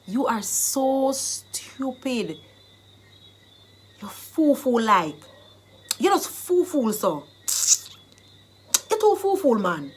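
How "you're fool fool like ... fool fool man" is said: Jamaican Patois. Shown as a demonstration. Teacher acting out calling someone stupid, in Jamaican Patois. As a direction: scold in Jamaican Patois, leaning on "fool". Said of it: "I've given you the Jamaican dialect".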